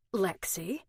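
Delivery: Lexi asked with a rising, curious foreign lilt; teasing accented echo. A narrator's reading. Cecil teasingly repeats Alex's nickname with a rising lilt. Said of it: "accent the way it curled".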